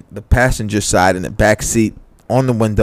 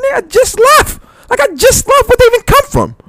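very high, frantic voice of the friend; mock panicked friend on the phone; 6 dB louder than usual. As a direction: squeal it in panicked disbelief.

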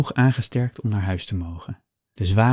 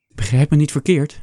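hasty reassurance; 5 semitones higher, 5 dB louder than usual. A narrator's reading. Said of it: "zegt hij snel".

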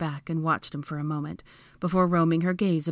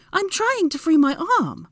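high, louder, exasperated voice; snippy protest; 13 semitones higher, 5 dB louder than usual. A narrator's reading. Hermione to Draco, arm trapped, snippy. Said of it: "she snipped back".

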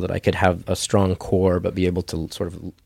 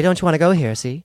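put-on high-pitched old talkie film dame; Shirley Saint Cloud; 8 semitones higher, faster than usual. Shown as a demonstration. Actor demoing the character's catchphrase, high old-movie voice. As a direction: play a forties movie lady, pitched up. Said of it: "old talkie films from the nineteen forties and fifties".

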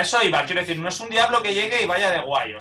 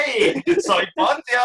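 loud, higher hammed-up greeting; cheery Pontius Pilate; 6 semitones higher, 5 dB louder, much slower than usual. Pontius Pilate introduces himself loudly in a film cameo. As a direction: announce yourself like a jolly Roman ham. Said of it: "Poncio Pilato siempre pasaba aunque fuera a saludar".

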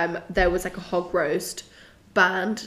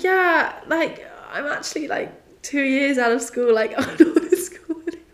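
laughing high; 6 semitones higher than usual. Retold or imitated. Explaining she left school.

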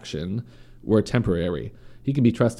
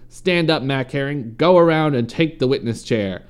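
raised, commanding courtroom voice; louder, higher pitch on each command; thundered; 4 semitones higher, 5 dB louder than usual. A narrator's reading. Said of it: "thundered across the crowded courtroom".

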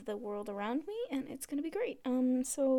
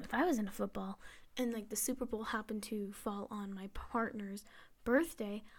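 normal talking voice

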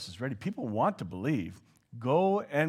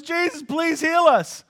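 pleading blind men; 14 dB louder than usual. Retold or imitated. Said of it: "All they said was".

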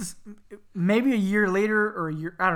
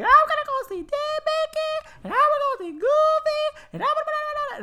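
put-on very high, squeaky excited kid; imitated little brother; 4 dB louder than usual. Little brother excited for the characters, imitated squeaky child. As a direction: squeal like a small boy at a theme park. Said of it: "he was little, of course, but he was like".

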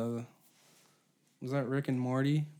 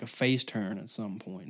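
ordinary talk

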